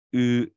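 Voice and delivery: 呃 as the retroflex vowel split off from 之; zhi vowel isolated. Shown as a demonstration. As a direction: sustain 呃 as the i of zhi, tongue curled. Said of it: "我拆开就是".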